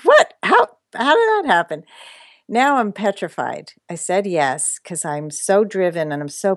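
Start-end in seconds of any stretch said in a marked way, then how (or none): 0.0-1.8 high-pitched, incredulous squeal of surprise; astonished disbelief. Retold or imitated. Speaker retelling being accepted for a TEDx talk, astonished. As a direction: replay your stunned reaction, voice leaping up.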